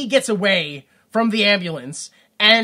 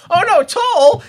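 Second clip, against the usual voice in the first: mock panic; 10 semitones higher, 7 dB louder, slower than usual. Imagined hero panic.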